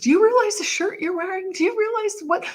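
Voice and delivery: high-pitched, urgent wonder; amazed exclaiming. Retold or imitated. Patient questioning her friend about his shirt, high excited amazement. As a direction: pitch it way up, as if astonished.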